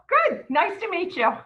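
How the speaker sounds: high, bubbly acceptance; cheery mock greeting. Greeting someone met for the first time, playfully delighted.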